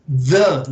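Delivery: THE set apart and said loud; stress on THE. Shown as a demonstration. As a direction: punch THE as a reminder. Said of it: "no se les olvide the".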